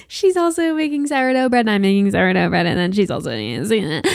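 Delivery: hammed-up wail running downhill; mock meltdown. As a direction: play-act being totally overwhelmed. Acts out overwhelmed reaction to sourdough news.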